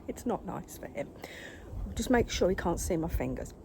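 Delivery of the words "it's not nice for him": softer unvoiced whisper. Real, not acted. Owner whispering near her agitated cat. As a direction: whisper it so the cat stays calm.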